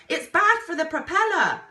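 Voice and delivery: roaring over the engine; roared. A narrator's reading. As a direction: bellow the warning. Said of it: "roared the explorer".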